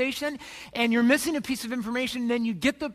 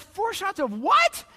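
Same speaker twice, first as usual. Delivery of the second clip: shocked disbelief; 8 semitones higher, 4 dB louder, slower than usual. Retold or imitated. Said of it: "I'm like".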